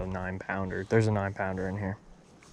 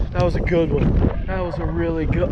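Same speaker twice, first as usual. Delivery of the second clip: excited lament; 6 semitones higher, 4 dB louder than usual. Real, not acted. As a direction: let it ring out in disbelief. Just lost a big fish.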